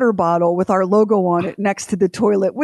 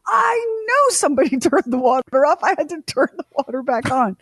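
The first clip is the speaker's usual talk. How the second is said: exasperated retort; 6 semitones higher than usual. Retold or imitated. Husband retorts about the water, exasperated and high-pitched. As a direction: wail it like a husband proven right.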